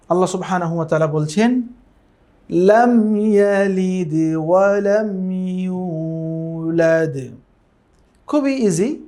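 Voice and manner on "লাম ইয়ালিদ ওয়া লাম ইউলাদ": slow melodic tilawat; Quran recitation. Shown as a demonstration. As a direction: chant it slowly, drawn out like in prayer. Recites the verse.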